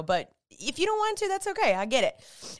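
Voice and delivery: ordinary talk, nothing marked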